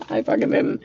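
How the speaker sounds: soft, breathless laugh through the words. Real, not acted. Admitting it to viewers, laughing quietly. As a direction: let a real laugh swallow the words. Laughs at own failed expectation.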